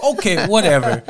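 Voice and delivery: laughing. Real, not acted. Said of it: "I'm like".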